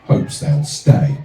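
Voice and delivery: measured rhythmic poem reading; poem recital. Acted. Comic poet reading his verse to the crowd, rhythmic recital. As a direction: recite it with a steady, rhyming lilt.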